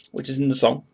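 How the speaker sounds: ordinary talk, nothing marked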